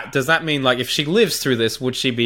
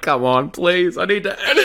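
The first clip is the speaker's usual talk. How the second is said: a playful whiny plea, laughing through it; mock-begging to edit; imitating Danny pleading; 5 semitones higher than usual. A friend mimicking Danny begging to be allowed to edit, in a laughing whiny plea. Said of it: "Danny would be like".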